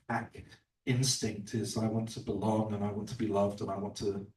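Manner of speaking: ordinary talk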